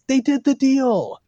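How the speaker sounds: high, drawn-out excited call; imitated commentator yell. Fan mimics commentator calling the Falcon Arrow, high excited yell. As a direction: mimic his signature yell, stretching it out. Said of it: "he yells".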